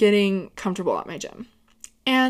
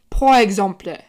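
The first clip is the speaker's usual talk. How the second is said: French accent; 5 dB louder than usual. Shown as a demonstration. Showing off her French to viewers, mock French accent. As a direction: pour exemple in a mock French accent, louder. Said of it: "I can do a killer French accent".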